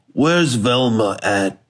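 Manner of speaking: usual speaking voice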